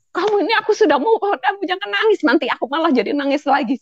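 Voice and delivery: high, quick, playful scolding of a crying friend; half-laughing reprimand, pitched high and fast; mock scolding. Scolding her crying friend, a mock angry high fast rebuke. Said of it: "Jadi saya malah marah".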